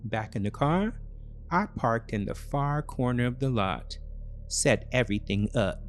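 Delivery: ordinary delivery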